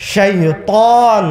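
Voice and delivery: শাইতান slowly drawn out with a weighty Arabic "t"; forceful recited pronunciation. Shown as a demonstration. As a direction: stretch শাইতান, leaning hard on the middle "t". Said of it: "এই অক্ষর উচ্চারণ করতে শক্তি লাগে".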